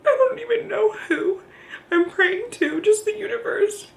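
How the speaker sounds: real tears, high straining voice; crying. Real, not acted. Mother recalling praying to the universe, crying. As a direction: talk through crying, voice lifted high.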